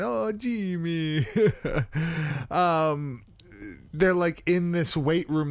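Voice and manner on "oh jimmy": put-on slow, higher Italian mother accent; drawn-out Italian mama sigh; Italian mom. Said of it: "the mom's super Italian".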